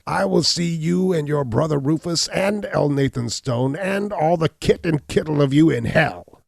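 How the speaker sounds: cornered hunchback cursing the whole family, screaming; raging shout. Acted. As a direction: scream damnation at them all. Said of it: "cried the hunchback".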